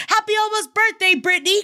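high, bright birthday cheer; excited. Acted. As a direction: call it out high with delight.

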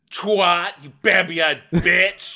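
high, loud drawn-out insult; imitating a furious outburst; mock rage. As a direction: yell it slowly as a hammed-up tirade. Imitating clip of castmate's wrath.

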